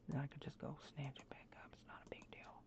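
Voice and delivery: fast unvoiced whisper to himself; real hushed self-talk; whispered aside. Real, not acted. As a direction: breathe it out quickly, calming down. Self-reassuring aside after loss.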